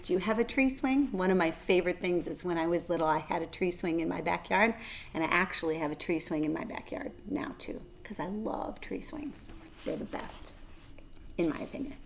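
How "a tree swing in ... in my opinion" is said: soft, breathy confiding whisper; whispered aside. Real, not acted. Host confides to young viewers, whispered. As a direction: whisper it like a secret.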